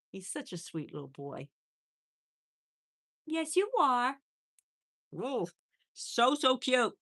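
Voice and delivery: high-pitched pet adoration; cooing. Real, not acted. Owner tells guinea pig it is cute, cooing. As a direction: gush over the little animal in a high coo.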